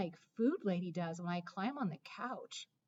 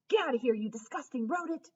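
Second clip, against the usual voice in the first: angry bark; 4 semitones higher, 5 dB louder, faster than usual. Acted. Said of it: "I bark".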